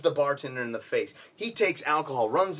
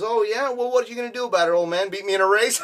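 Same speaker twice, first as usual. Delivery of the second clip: higher, louder, fast mocking challenge; taunting; 7 semitones higher, 5 dB louder, faster than usual. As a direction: goad him like a cocky upstart. Nabben taunting the old champ.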